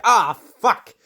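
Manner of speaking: high, loud hammed-up yelp of pain; mock pained curse. Mandalorian's weapon gets stomped, high hammed-up yelp. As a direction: yelp it like a hit fighter. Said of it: "He's like".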